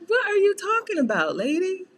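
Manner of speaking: high, baffled question; mock baffled daughter. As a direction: go high and incredulous at mom. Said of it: "I'm like".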